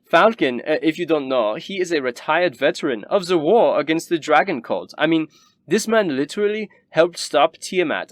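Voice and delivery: high, gleeful cruel snob; a rich noble bragging about killing squirrels; Rabaya's voice. Acted. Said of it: "Rabaya kinda nods and says".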